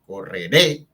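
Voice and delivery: correré rising into a strong -ré; co-rre-RÉ, end pushed up; stress on last syllable. Shown as a demonstration. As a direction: lean on the final syllable of correré. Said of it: "la fuerza de entonación está en la última".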